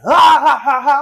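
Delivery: high, loud imitated laugh; mock laugh. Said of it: "just blurts out laughing".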